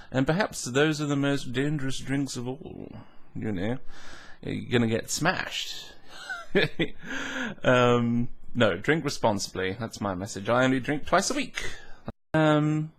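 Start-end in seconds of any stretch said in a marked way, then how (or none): 6.0-7.6 high chuckle; real laugh. Real, not acted. Joking about getting smashed, real laugh. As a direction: laugh at own joke.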